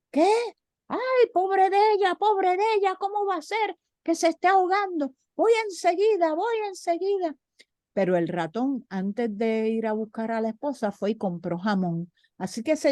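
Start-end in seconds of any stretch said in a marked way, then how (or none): 0.1-7.4 high worried mouse voice; alarmed little mouse. Acted. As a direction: squeak in panic for his wife. Mouse hears wife drowning.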